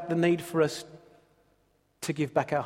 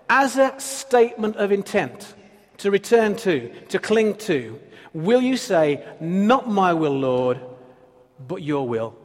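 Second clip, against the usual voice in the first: preaching; 3 semitones higher, 3 dB louder than usual. Acted. Altar call to commitment.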